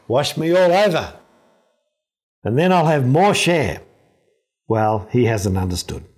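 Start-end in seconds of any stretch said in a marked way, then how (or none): 0.1-3.8 higher, eager plea to be washed all over; eager Peter. Acted. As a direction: Peter getting carried away, pitched up. Peter eagerly demands full washing.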